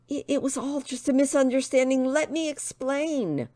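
high, stammering excuse; flustered pleading. Acted. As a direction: start in a rush, then beg to explain. Tristan placates the angry woman.

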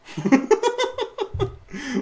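long high giggle; high laughing burst. Real, not acted. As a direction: break into laughter. Laughs after saying no.

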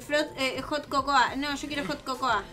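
normal talking voice

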